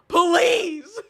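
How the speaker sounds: high, loud, drawn-out begging; desperate plea. Real, not acted. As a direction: beg them at full voice. Begs chat to seek help.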